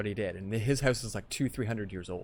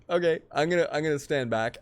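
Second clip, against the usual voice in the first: nervous backing off; 5 semitones higher, 5 dB louder than usual. Retold or imitated. Backing away from smashing friend.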